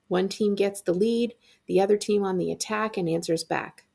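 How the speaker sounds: ordinary delivery, nothing marked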